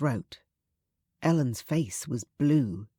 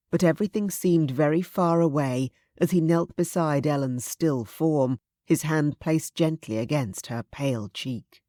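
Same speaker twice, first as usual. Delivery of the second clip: ordinary delivery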